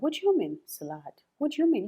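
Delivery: high-pitched, baffled question; puzzled native speaker. Retold or imitated. Native speaker struggling to understand the learner, puzzled and high-pitched. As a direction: imitate a confused listener, pitch jumping up. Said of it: "to a native speaker, they might struggle".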